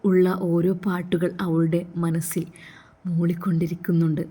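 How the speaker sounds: ordinary delivery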